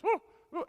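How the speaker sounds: high, loud yipping woofs; imitated dog barking on command; short, high-pitched mock barks. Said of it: "Bark like a dog".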